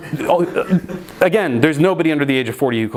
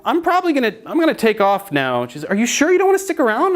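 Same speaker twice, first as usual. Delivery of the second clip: nervous high voice; 8 semitones higher than usual. Retold or imitated. High nervous exit, sweet reply.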